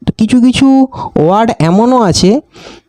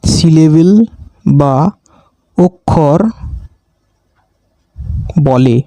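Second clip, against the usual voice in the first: word-by-word dictation; 5 semitones lower, slower than usual. Shown as a demonstration.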